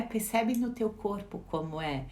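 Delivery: ordinary delivery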